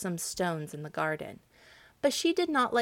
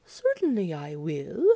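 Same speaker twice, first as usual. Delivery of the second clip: a lightly voiced cow, slow and low; mild cow voice, slow; kindly cow; 3 semitones lower than usual. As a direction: agree slowly like a placid cow. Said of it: "answered the cow".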